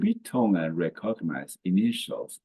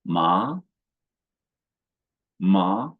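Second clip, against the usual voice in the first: "Ma" rising like a question; "Ma" with a Mandarin rising tone; second tone; 5 dB louder than usual. Shown as a demonstration. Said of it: "The second tone is rising".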